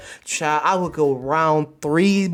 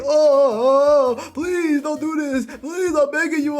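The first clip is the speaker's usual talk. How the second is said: high, slow begging whine; pleading high voice; 9 semitones higher, slower than usual. Retold or imitated. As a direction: beg like a desperate man. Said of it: "my man, he all like".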